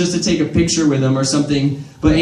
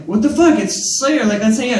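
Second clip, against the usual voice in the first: incredulous; 5 semitones higher than usual. Retold or imitated. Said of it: "And I'm like".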